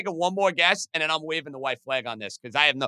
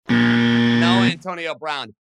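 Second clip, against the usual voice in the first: low, loud and very slow denial; deep game-show no; 5 semitones lower, 6 dB louder, much slower than usual. Acted. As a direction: drag it out like a buzzer rejection. Rejects own guess in mock voice.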